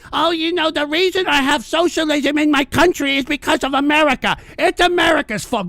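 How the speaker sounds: sneering imitation; mocking whine. Mocking a Canadian donor.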